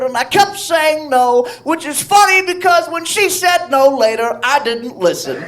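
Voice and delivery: high put-on celebrity voice for the punchline; Cosby voice. Comedian telling the bar story in a put-on Cosby voice. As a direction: deliver the dark joke in a lightly done Cosby voice.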